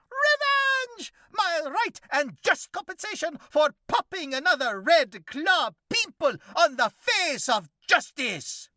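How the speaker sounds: high, loud, mock-heroic vigilante voice; hammed-up cockroach hero. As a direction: declaim like a pompous masked avenger. Cockroach justifies the theft.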